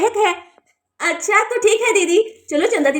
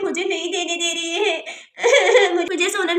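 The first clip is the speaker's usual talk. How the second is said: slow whiny complaint; 4 dB quieter, slower than usual. Acted. Child complains to mother.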